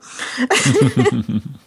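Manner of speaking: a quick amused laugh; short laugh. Real, not acted. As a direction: laugh it off lightly. Laughing at own joke.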